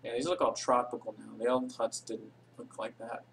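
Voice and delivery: usual speaking voice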